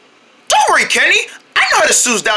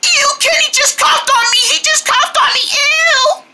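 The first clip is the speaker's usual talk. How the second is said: disgusted squeal; 13 semitones higher than usual. Acted. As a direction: wail about being coughed on. Girl disgusted by cough.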